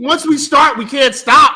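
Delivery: loud, high hype shout; excited shout. Real, not acted. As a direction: yell it pumped up. Hyped about tight end room.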